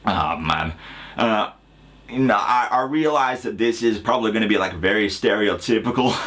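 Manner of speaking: hesitant fake American accent. Shown as a demonstration. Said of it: "Can you please do an American accent".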